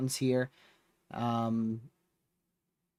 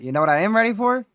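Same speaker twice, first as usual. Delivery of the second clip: higher, louder and fast teasing question; playful build-up; 7 semitones higher, 6 dB louder, much faster than usual. Real, not acted. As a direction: set up the joke with a quick lift. Tired streamer teasing punchline.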